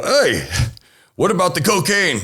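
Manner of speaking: high-pitched indignant call-back; calling after someone. Retold or imitated. Buyer calling after departing policeman, high-pitched protest. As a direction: call out to stop him leaving.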